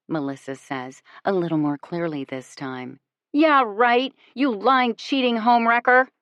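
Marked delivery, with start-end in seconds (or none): 3.3-6.1 higher and louder, furious shout. A narrator's reading. At the other woman in the background, an angry shout. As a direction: hurl it at her. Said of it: "I shout".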